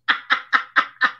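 breathy laughter. Real, not acted. Laughing at herself.

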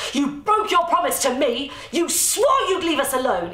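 loud furious accusation; screamed. Acted. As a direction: scream the betrayal at him. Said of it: "Easter screamed".

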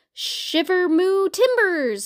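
slow, drawn-out moo, high pitched; mooing pirate cow. Acted. Cow suggests a pirate costume, mooing slowly. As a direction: stretch the moo into a pirate cry.

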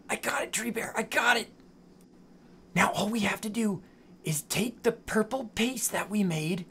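whispering. Real, not acted. Sneaking while ninjas are near.

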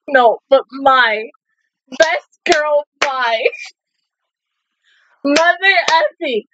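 ordinary talk

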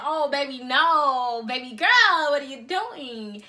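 loud, exaggerated disbelief; mock dismay. Vlogger reacting to everybody else's mukbangs, mock dismay. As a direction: scold them in playful disbelief, stretching the end. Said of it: "I'm like".

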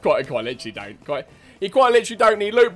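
stumbling, repeated word starts; stuttering. Real, not acted. Player hammering at rivals about loot, stuttering. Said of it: "Stop stuttering".

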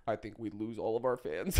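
very low, soft put-on bass; reply in a mock deep voice; dropped low and quiet. Shown as a demonstration. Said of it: "talking like this".

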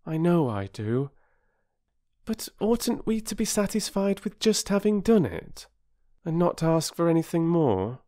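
put-on high, earnest questioning girl; doubtful girl. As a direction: question it as a scrupulous child. Voices doubtful Bobby in the story.

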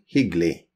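hyggelig said hygli, G dropped; silent G. Shown as a demonstration. Teacher to learners, saying hyggelig without the G. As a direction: swallow the G in hyggelig. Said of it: "The G is silent".

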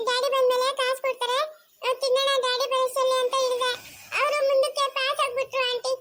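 put-on high child's voice, innocent and pleading; a lost small girl explaining to the lady officer; little girl telling her story. (ಮುಗ್ಧ ಪುಟ್ಟ ಹುಡುಗಿಯ ಬೇಡಿಕೆ)

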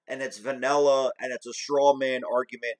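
ordinary delivery

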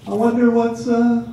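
high-pitched, soft mock wondering; curious. Man curious about long dresses, high mock-wondering voice. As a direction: wonder aloud in a small high voice. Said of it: "just, like, curious".

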